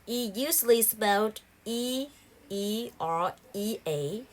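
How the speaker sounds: normal talking voice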